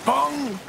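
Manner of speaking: bong sound. Shown as a demonstration. Sound effect in story.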